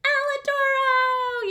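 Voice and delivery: loud, high, drawn-out shout of the name. Shown as a demonstration. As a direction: call the name out big. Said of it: "Throw it at me".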